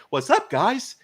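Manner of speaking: high, bouncy greeting; chipper superhero actor. Retold or imitated. Flash actor jogs over to police, chipper.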